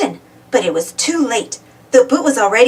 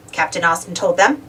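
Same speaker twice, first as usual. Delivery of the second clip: ordinary delivery, nothing marked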